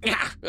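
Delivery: high, short cries of "ah"; imitated cries. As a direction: imitate startled creatures crying out. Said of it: "kind of like".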